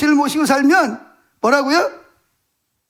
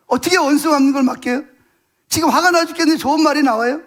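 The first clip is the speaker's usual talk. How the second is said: ordinary talk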